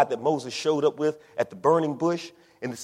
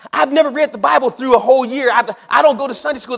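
high, loud mock protest; protesting excuse; 9 semitones higher, 9 dB louder than usual. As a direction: pile on the excuse, high and hurried. Voices believer making excuses.

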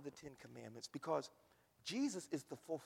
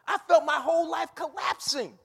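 anguished shout; 12 semitones higher, 18 dB louder than usual. Real, not acted. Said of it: "shouted anguish".

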